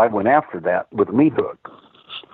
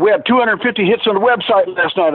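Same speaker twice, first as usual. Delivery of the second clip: retold furious rant, much higher pitch; 9 semitones higher than usual. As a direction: imitate the angry webmaster yelling. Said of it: "He was furious".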